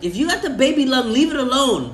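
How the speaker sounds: high, loud mock scold. Friend mocks cohost's baby lung, in a high loud mock voice. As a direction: tease him in a put-on voice. Teasing friend about vaping.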